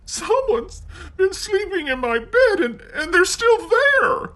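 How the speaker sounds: put-on very high, excited baby bear; Baby Bear finds Goldilocks in his bed, very high and excited; cried. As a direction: squeal it as the smallest bear. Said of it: "he cried".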